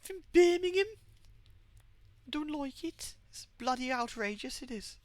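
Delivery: high-pitched, drawn-out Brummie mum; mum's Birmingham accent. Retold or imitated. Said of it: "she talks like this".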